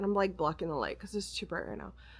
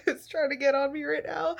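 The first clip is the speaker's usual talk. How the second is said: ordinary talk, nothing marked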